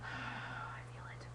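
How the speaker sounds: slow unvoiced whisper after a sigh. Real, not acted. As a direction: sigh it out, barely a breath. Annoyed aside, trailing sigh.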